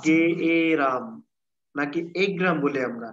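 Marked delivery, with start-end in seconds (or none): 0.1-1.0 "গে এ রাম" said syllable by syllable, slowly; slow motion. Shown as a demonstration. Teacher slowing down the word for students. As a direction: stretch "গে এ রাম" into separate slow sounds. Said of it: "slow motion উচ্চারণ করে দেখো".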